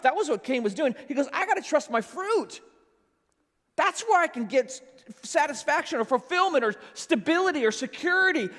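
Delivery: loud, high, impassioned pulpit voice; a fiery sermon crescendo to the congregation; full-force preaching. Acted. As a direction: preach it out, rising and urgent.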